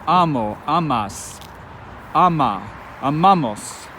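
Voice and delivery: "Amo", "amas", "ama", "amamos" each stressed on the second to last syllable; "amamos" said a-MA-mos; penult stress drill. Shown as a demonstration. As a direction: drill the forms, leaning on the next to last syllable. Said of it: "the second to last syllable".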